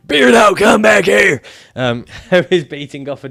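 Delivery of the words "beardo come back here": loud, high mock yell across the room; much louder and higher, hammed-up shouting; imitating a shout. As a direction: bellow it after him like the shouter. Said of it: "from all his shouting".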